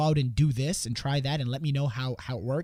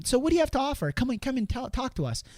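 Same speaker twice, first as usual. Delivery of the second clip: much higher, eager question; eager wife; 9 semitones higher than usual. Retold or imitated. Wife asking the salesman, high eager voice. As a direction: ask like a curious keen wife. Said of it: "the wife, was, um".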